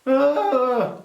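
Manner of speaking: loud, high "ah" of despair; hammed-up cry of frustration; exasperated groan. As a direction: wail in mock agony. Despairs at American airfoil.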